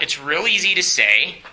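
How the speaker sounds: ordinary delivery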